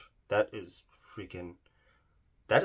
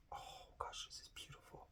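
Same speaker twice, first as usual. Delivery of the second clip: awed whisper; 19 dB quieter than usual. Real, not acted. Gasping at a beautiful shade.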